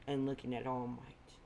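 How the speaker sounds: plain reading voice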